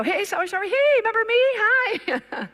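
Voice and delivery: high, bright-eyed doorstep hello; eager chirpy greeting. Retold or imitated. Visitor chirps a hopeful hello at the door.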